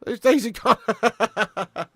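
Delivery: high, loud words dissolving into laughter; breaking into laughter. Real, not acted. Streamer teasing Irish fans about their team, cracking up. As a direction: crack up halfway through the line, drawing it out. Cracks up repeating his jibe.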